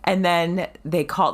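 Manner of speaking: ordinary delivery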